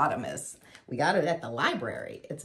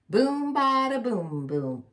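bass drum; 6 semitones higher than usual. A narrator's reading. Warthog bangs his belly, booming bass drum. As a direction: boom it out like a big drum. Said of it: "like a big bass drum".

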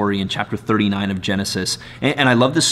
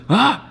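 a sudden, much higher "Ah!" as he bolts; startled ah; 12 semitones higher than usual. Acted. Joseph cries out as he runs from Potiphar's wife, startled yelp. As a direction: yelp "Ah" like someone panicking and fleeing. Joseph's cry before fleeing.

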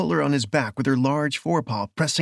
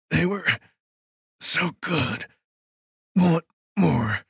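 strained, higher-pitched rapture; ecstatic killer; 4 semitones higher, slower than usual. Acted. Killer confessing with relish while pinned down, ecstatic. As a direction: savour it word by word, as if in ecstasy. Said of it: "As if in ecstasy".

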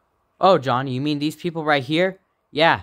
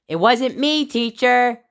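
put-on high kid voice; 9 semitones higher, 3 dB louder, slower than usual. Kid denies to teacher.

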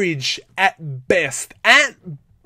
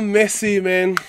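ordinary talk, nothing marked

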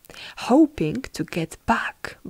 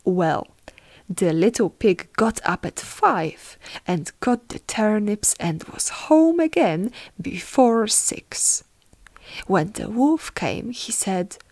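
ordinary delivery

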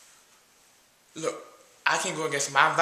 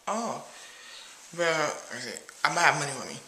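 ordinary talk, nothing marked

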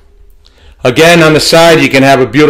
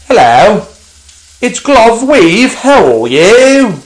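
high, slow puppet greeting; glove puppet voice; 8 semitones higher, slower than usual. Pretend. Glove mitt says hello to viewers, puppet voice. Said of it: "glove mitt on".

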